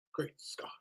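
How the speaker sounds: hushed mock-awed Great Scott; whisper. Real, not acted. Stunned by the comment, a mock-awed whisper. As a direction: breathe it like a secret discovery.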